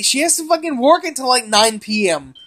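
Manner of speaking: normal talking voice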